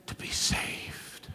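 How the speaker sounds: slow whispered close. Acted. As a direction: breathe the words out slowly. Preacher drops to a whisper.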